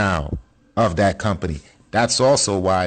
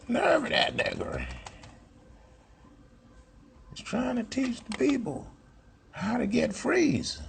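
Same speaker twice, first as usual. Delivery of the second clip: much higher, softer sneering mock voice; high mock voice of a hater; mocking a critic; 8 dB quieter than usual.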